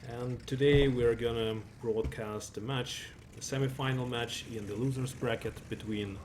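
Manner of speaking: loud, bright-paced broadcast welcome; announcer intro. Acted. Caster greeting stream viewers at match start, loud announcer voice. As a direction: open the show like a tournament announcer.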